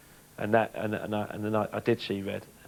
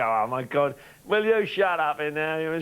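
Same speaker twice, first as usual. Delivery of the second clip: imitating a fed-up teammate, pitch lifted; acted-out exasperation; exasperated mock complaint; 6 semitones higher, much slower than usual. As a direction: comically protest at the racket. Acted reaction to teammate singing.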